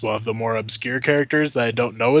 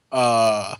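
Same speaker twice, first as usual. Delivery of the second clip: ordinary talk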